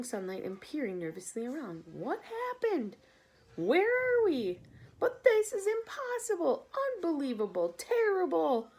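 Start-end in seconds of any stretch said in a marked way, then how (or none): 1.9-8.7 shocked dismay. Acted. Bugs cry out at seeing the sea, high and stunned. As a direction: reel in disbelief.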